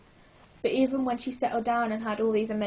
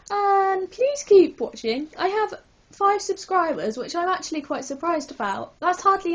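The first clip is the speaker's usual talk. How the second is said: higher, louder, drawn-out excited plea; hyper; 7 semitones higher, 6 dB louder than usual. Real, not acted. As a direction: gush it out, giddy and hyper. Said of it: "I'm really hyper".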